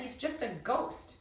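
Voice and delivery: ordinary delivery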